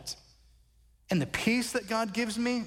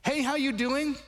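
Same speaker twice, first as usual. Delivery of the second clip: bright, higher, repeated hellos at the door; cheery church greeting; 7 semitones higher than usual. Retold or imitated. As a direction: greet each person warmly as they walk in. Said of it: "I must have asked thirty people".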